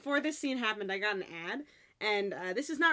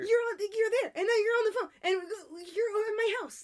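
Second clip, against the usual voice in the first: high, loud, bubbling amazement; excited disbelief; 12 semitones higher, 7 dB louder than usual. Retold or imitated. Eun-chan seeing him at her house, high and amazed. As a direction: gush at him, overwhelmed. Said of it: "pointing at him and going".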